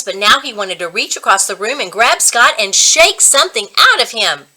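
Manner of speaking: ordinary delivery, nothing marked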